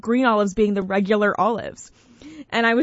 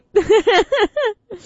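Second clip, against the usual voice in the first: high, loud burst of laughter; 12 semitones higher, 3 dB louder than usual. Real, not acted. Host laughing at the stubborn-partner bit, high laugh. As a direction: laugh at your own joke.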